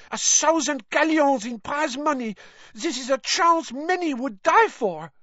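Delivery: high, proud voice, still bristling with indignation; indignant young witch. A narrator's reading. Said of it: "burst out Fleur Delacour".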